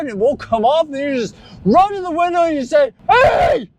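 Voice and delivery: building from calm to a high shouted rant; movie-speech rant rising to a shout; quoting Stanley Spadowski. Retold or imitated. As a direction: start mild, climb into a yelling window rant. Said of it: "that speech from Stanley Spadowski".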